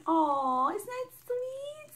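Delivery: very high, slow tender coo. Real, not acted. Admiring the sweet card message, cooing high. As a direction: melt over the card.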